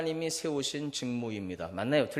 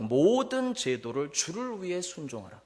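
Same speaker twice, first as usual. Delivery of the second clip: scripture reading; 4 semitones higher, 5 dB louder than usual. Acted. Reads old translation aloud.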